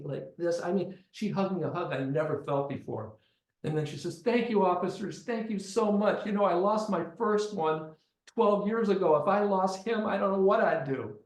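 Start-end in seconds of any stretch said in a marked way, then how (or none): 4.2-11.2 higher, louder distraught mother's voice; grateful mother. Retold or imitated. Mother thanking police for her found son, overwrought gratitude. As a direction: pour out relieved thanks, high and loud.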